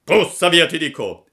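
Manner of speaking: loud, high order to get away; insistent shout. A narrator's reading. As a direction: bellow it as a threat. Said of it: "gli ripeté il pescatore". (grido minaccioso e insistente)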